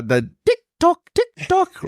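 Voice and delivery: very high, squeaky mock chime; silly high voice. Co-host plugging the show's socials, silly very high voice. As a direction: pipe it up comically high. Comic plug in mock Canadian sign-off.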